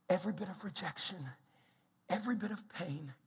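hushed, low, compassionate answer; tender compassion. Acted. God answering someone hurting, tender. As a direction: speak softly, leaving space between phrases.

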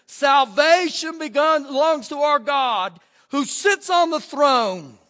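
slow, loud cry of praise; loud proclamation. Acted. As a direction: proclaim it slowly to the throne. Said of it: "crying out with a loud voice".

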